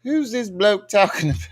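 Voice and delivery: high-pitched put-on English accent. Shown as a demonstration. Showing how every comment will be read, in English accent. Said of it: "I'ma read that shit with an English accent".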